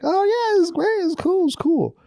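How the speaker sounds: high-pitched, mock-polite acceptance; put-on, high breezy approval; feigned approval. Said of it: "They all, like, pretended".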